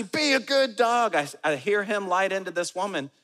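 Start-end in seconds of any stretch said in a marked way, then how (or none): 0.0-1.1 high, loud, drawn-out scolding; exaggerated stretched-out command; mock dog-owner shout. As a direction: yell slowly at the dog, hammed up.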